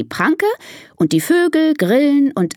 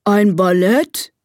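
slow, puzzled elephant question; tooting elephant; slower than usual. Acted. Elephant Gustav startled by the ballet, slow tooted question. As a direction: draw it out, rising in disbelief. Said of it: "trötet er".

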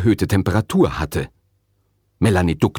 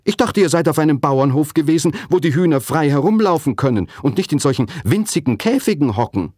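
higher-pitched, indignant father; 9 semitones higher than usual. A narrator's reading. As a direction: protest with rising outrage. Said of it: "fragte Papa empört".

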